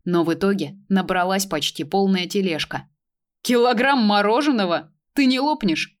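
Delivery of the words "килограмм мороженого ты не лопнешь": mocking, higher and louder question; sarcastic. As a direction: tease her with sarcasm. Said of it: "саркастично поинтересовался Захар".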